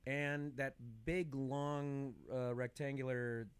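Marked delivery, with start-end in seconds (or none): none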